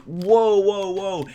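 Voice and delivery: high exclamation, loud at first; imitated taken aback. Teen halting a cursing husband, mock shock. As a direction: push back against an outburst.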